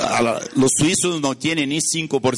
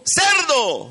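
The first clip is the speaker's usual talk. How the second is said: high, sharp yell of "Cerdo"; shouted warning; 12 semitones higher than usual. Retold or imitated. Driver yelling a warning at the passing car, high shout. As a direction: fling it high out the window. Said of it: "le gritó".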